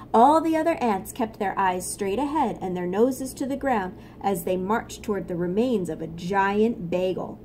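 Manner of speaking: plain reading voice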